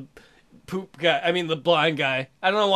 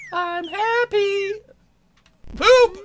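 high slow cartoon voice; poop character voice; 13 semitones higher, 4 dB louder, much slower than usual. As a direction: squeak it out as the happy poop.

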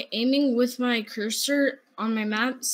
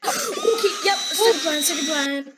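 urgent shouting; 4 semitones higher, 4 dB louder than usual. Real, not acted. Panicked calling in firefight.

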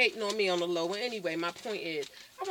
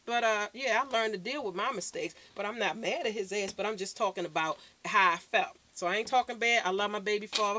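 ordinary talk

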